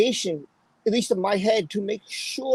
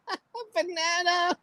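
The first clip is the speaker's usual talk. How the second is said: laughing through the words; 8 semitones higher, 5 dB quieter than usual. Real, not acted. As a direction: giggles while saying "A banana.".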